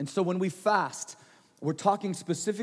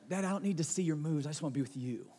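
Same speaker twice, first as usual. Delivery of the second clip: put-on softer, a light touch of a little girl's plea; softly voiced daughter; 5 semitones lower, 8 dB quieter, faster than usual. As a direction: quietly tell dad you just want him. Daughter gently turns down his moves.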